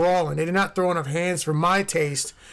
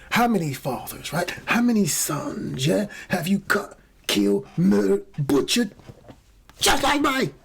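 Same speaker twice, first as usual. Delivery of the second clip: a rough mimic of a menacing Tom Hardy line; Tom Hardy quote; 2 semitones higher than usual. Host quoting Tom Hardy's film line, lightly imitated. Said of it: "Tom Hardy".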